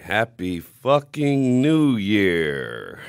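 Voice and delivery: drawn-out, loud celebratory yell; stretched, booming Happy fucking New Year; shouted greeting. Real, not acted. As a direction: belt it out slowly like a party toast. Opening shout to the audience.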